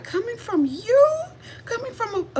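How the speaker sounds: lightly voiced delighted woman; gushing girl. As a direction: imitate a flattered girl. Imitating a flattered woman.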